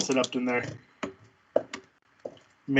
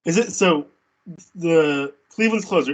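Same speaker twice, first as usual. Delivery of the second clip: ordinary talk, nothing marked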